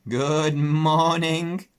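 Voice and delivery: drawn-out, bleating sheep chorus; sheep greeting. Acted. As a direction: sheep greeting the pig together.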